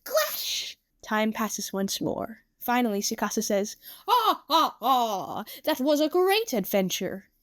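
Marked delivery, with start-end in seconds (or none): 0.0-0.8 high-pitched cry of a sword strike; play-fight sound effect. Acted. 4.1-7.3 hammy hero's laugh, starting high; theatrical, satisfied glee; triumphant prince. Acted. Prince laughs after winning play battle.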